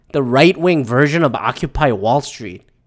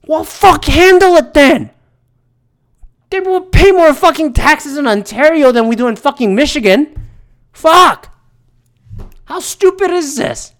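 high, loud exasperated outburst; angry rant; 10 semitones higher, 4 dB louder than usual. Real, not acted. Ranter blasting officials who won't handle it, exasperated yelling. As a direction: yell it out, real fed-up fury. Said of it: "That's my rant".

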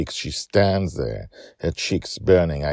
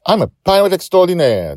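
fast, loud, higher proud declaration; declaring; 14 semitones higher, 9 dB louder, faster than usual. Acted. Joy boldly declares herself to her dad. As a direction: announce it to the world. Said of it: "Joy declares".

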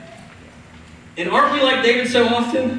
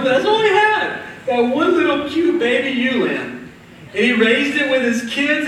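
ordinary talk, nothing marked

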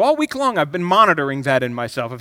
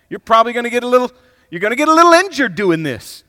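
self-pep talk; 6 semitones higher, 8 dB louder than usual. Retold or imitated. Preacher voicing self-talk, rising.

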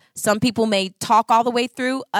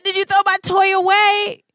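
put-on high, louder kid voice; upset child; 10 semitones higher, 6 dB louder than usual. Mother retelling her son's question about his toy. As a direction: mimic a hurt little boy.

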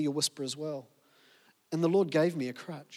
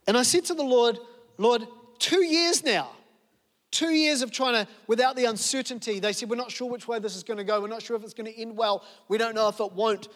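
impassioned preaching; 5 semitones higher than usual. Real, not acted. Impassioned climax of sermon.

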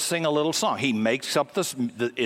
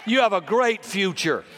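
preaching at full force; 7 semitones higher than usual. Acted. Preacher to congregation, preaching at full force. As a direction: press the promise home, building.

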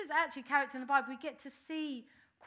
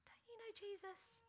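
put-on higher, softer girl's question; voicing a girl; 8 semitones higher, 16 dB quieter than usual. A girl at the court questioning Peter, higher and softer. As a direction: lift into a young girl's voice, lightly.

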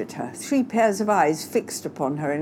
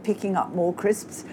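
ordinary delivery

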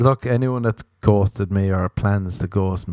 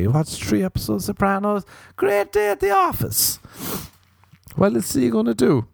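excited; 11 semitones higher than usual. Real, not acted. Praising Sopranos.

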